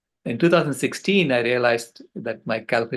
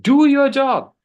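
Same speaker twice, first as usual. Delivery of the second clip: put-on much higher, louder stern child command; child's scolding command; 13 semitones higher, 9 dB louder, slower than usual. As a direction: bark it like a bossy little girl wagging a finger. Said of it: "She shook her finger in my face".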